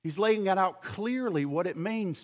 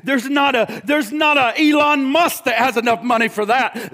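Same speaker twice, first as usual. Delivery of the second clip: loud, driving preacher's cadence; full-force preaching; 4 semitones higher, 7 dB louder than usual. Acted. Preacher to congregation about the treasure, full-force preaching.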